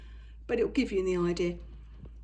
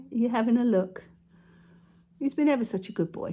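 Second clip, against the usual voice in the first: pet talk to cat; 4 dB louder than usual. Real, not acted. Talking fondly to her cat.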